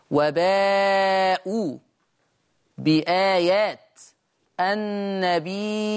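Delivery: slow وباءوا بآيات النبيين with each long vowel stretched; recited وباءوا بآيات النبيين with extended madd; lengthened badal. Shown as a demonstration. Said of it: "بتثليث البدل".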